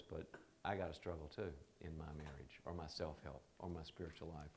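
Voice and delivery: understated confiding tone; hushed confession. Retold or imitated. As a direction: admit it quietly like a secret. Friend's quiet confession.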